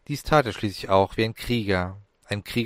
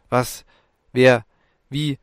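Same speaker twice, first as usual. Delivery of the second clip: stammering; 3 semitones higher, 6 dB louder, slower than usual. A narrator's reading. Ellen stammering at the glowing ghost in shock. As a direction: stumble over the three words in shock. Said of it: "stammelte Ellen".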